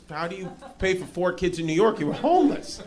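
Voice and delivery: ordinary talk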